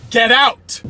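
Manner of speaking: loud, high barked order; angry shout. Acted. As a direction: yell at him to get out of the car. Dad orders son out.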